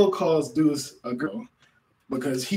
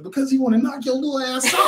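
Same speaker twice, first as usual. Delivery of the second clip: laughing retort; 10 semitones higher, 7 dB louder, faster than usual. Retold or imitated. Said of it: "I was like".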